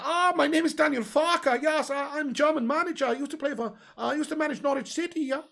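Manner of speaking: put-on high-pitched German accent; comic high German voice; Daniel Farke. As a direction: play a German football manager, pitched right up. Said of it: "I just always do Germans with a high-pitched voice".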